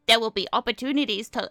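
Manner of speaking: loud, squawky bouncy penguin. Pretend. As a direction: read it like a cheerful cartoon penguin. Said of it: "Should I read it in my penguin voice?".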